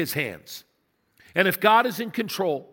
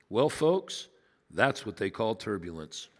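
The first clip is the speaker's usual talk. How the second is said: lower, softer laid-back pilot with a chuckle; casual pilot; 9 semitones lower, 4 dB quieter than usual. Retold or imitated. Pilot on the intercom calmly downplaying the turbulence, easygoing. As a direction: play it cool like an unbothered pilot. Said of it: "He came on and he said this".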